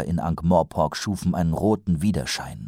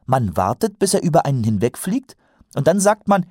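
higher voice, building in excitement; earnest young watchman, eager; 8 semitones higher, 4 dB louder than usual. A narrator's reading. Recruit explains dragon-slaying method to colleagues, eager build-up. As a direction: explain the plan with naive zeal.

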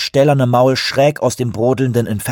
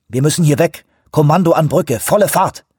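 shouting; 6 semitones higher, 4 dB louder than usual. Acted. Urgent order into microphone.

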